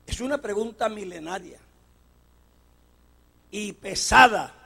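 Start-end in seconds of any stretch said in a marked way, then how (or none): none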